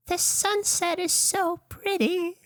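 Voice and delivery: put-on high, soft, slow child voice; dreamy little kid marveling. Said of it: "and he's like".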